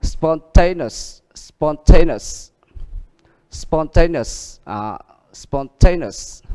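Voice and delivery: spon-TA-neous, slow and falling; slow classroom model of spontaneous; stress on ta. Shown as a demonstration. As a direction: say spontaneous slowly, leaning on ta. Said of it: "các bạn chú ý đó".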